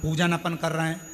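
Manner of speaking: loud, driving preaching to the crowd; fiery preaching. Acted. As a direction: hammer each line home like a pulpit sermon. Preacher builds to fervor.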